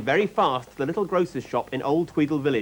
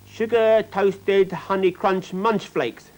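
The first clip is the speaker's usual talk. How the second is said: put-on slow demanding child; 7 semitones higher, 4 dB louder, much slower than usual. A narrator's reading. Child dictating cereal order.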